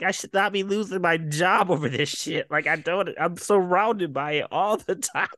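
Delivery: high-pitched indignant complaint; mock mad. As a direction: imitate a man pressed and protesting. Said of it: "he's just so mad".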